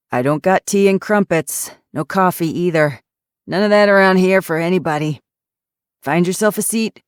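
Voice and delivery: put-on loud, grumpy old ex-cop; gruff old man. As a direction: snap it like a surly old man. Grumpy retired cop speaks.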